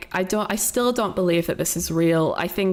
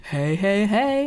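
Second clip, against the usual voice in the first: playful greeting; 2 semitones higher than usual. Real, not acted. Breaking off the recap to say hello to Bingo, playful greeting.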